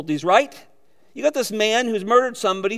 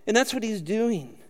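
ordinary talk

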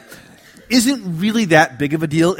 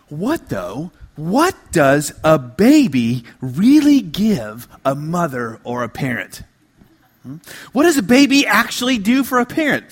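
ordinary talk, nothing marked

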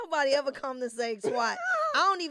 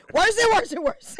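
laughing exasperation; 8 semitones higher, 7 dB louder than usual. Real, not acted. Laughing at own lies.

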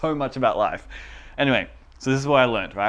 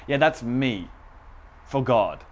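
normal talking voice

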